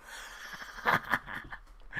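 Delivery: breathy, stuttering laughter; wheezing laugh. Real, not acted. Cracking up at the pronunciation.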